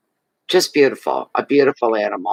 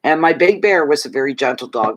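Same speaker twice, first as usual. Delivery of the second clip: ordinary talk, nothing marked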